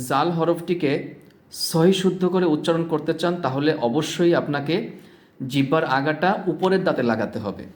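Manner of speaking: usual speaking voice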